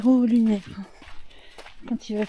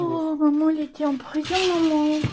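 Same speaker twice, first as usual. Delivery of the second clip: mock lament; 6 semitones higher, 4 dB louder than usual. Pretend lament about mum in prison.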